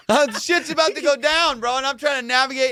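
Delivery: high, hyped storytelling; high excited telling. Real, not acted. Telling how the car was about to run dry, high hype. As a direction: build the panic with a high voice.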